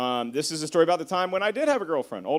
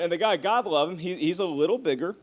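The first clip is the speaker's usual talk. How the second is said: ordinary delivery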